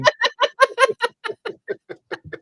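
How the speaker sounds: squealing high laugh; high shrieking laugh. Real, not acted. Cohost cackles after the tables turned. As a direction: burst into a shrill giggle.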